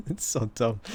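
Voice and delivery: usual speaking voice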